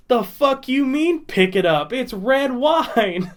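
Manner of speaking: very loud. Retold or imitated. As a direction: bark it out like he can't believe they'd wipe it. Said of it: "very loudly".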